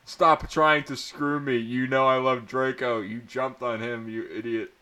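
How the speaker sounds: ordinary delivery, nothing marked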